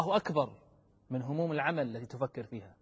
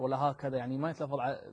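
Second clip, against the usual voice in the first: usual speaking voice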